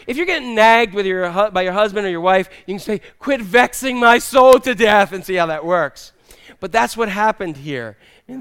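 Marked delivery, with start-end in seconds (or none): 3.2-5.1 higher, louder, slow protest; hammed-up exasperation; mock indignant retort. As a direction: complain grandly to a nagging spouse. Acted retort to nagging spouse.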